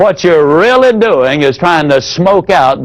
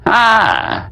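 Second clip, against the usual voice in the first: hesitant prospect; 6 semitones higher, much slower than usual. Retold or imitated. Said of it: "one prospect might say".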